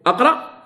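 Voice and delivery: "أقرأ" as aqra, opening on a; initial fatha. Shown as a demonstration. Teacher testing fatha on another verb, "أقرأ" begun with a. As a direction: begin "أقرأ" with an open a before the q. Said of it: "تنطق بهمزة الوصل بالفتح".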